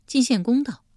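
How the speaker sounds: ordinary delivery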